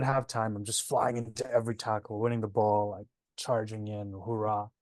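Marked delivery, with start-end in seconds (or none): none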